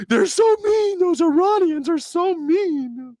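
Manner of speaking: very high, slow gasping whine; mock whiny victim. Mocking America's reaction to Iran, high wounded whine. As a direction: clutch pearls in a shrill hurt voice. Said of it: "And we're like".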